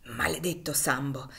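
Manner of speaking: whispered curse under the breath; muttering. A narrator's reading. Legré cursing to himself over his drink, muttered. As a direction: grumble it through the teeth. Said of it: "mormorava fra i denti".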